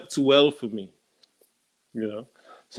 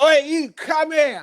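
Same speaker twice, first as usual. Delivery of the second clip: high loud street call in a different voice; 11 semitones higher, 3 dB louder than usual. Retold or imitated. Street seller calling the buyer back, high shout. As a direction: bark him back over.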